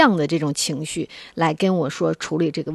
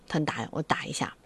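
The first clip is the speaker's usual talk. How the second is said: whispered, barely voiced; whispered aside; 3 semitones lower, 11 dB quieter than usual. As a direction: drop to a whisper as if to yourself. Mother recalls first strike. (低声耳语)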